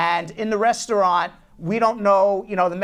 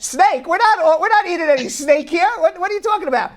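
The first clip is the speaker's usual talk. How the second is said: high, loud, alarmed disbelief, acted out; incredulous protest; 10 semitones higher, 5 dB louder than usual. Diners retold balking at being served snake, incredulous. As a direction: balk at it, pitch jumping up. Retelling shocked reply to waiter.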